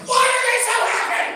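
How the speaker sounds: very high and loud, baffled; baffled listener squealing at a pop song; shrill mock question. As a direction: squeal it in disbelief.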